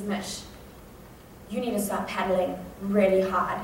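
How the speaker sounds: soft, low warning, holding back panic; quiet dread. Retold or imitated. Brother warns his sister to start paddling, quiet dread. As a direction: keep it hushed and grave.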